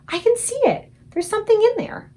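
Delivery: put-on very high, loud little-girl cry; excited cry. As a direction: squeal it out in wonder. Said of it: "cried out".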